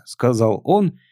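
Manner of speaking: ordinary delivery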